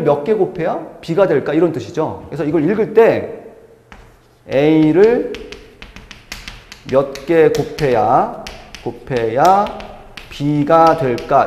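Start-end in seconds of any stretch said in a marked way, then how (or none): 4.5-11.5 slow, lower, word by word; slow meaning reading. Shown as a demonstration. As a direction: read it out slowly as a demonstration. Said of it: "이렇게 읽으시는 거예요". (천천히 뜻으로 읽기 시범)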